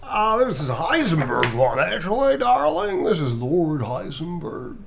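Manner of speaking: loud, swooping, grandiose lilt; theatrical character voice. Acted. Reading a print request as a grand character, theatrical. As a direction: announce it with high arch flourish.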